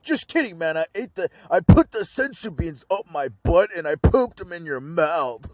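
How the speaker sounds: high, jokey put-on voice; mock goofy voice. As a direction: ham up a silly prank confession. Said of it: "I'm like".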